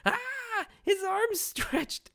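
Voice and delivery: very high, drawn-out imitated panic; hysterical mock scream. Imitating Isa in full-on panic mode, hysterical mock scream. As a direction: shriek in hammed-up hysterics. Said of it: "she's hysterically screaming".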